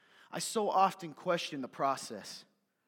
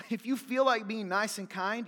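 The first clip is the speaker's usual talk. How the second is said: normal talking voice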